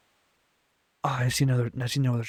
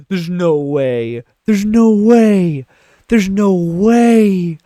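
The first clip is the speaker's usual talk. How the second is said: loud, very slow, stretched words; wailing disbelief; 5 semitones higher, 10 dB louder, much slower than usual. Real, not acted. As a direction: drag it out in a rising wail. Disbelief at the crash.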